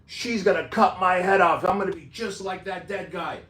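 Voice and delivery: imitating a man in a panic; freaking out. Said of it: "he's freaking out".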